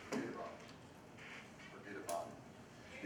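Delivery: whispered. Acted. Actor mutters in despair.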